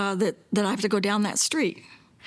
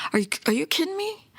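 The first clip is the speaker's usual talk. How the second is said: higher, quick, incredulous retort; retelling an indignant comeback; retold snap; 8 semitones higher, faster than usual. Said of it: "I kinda sna".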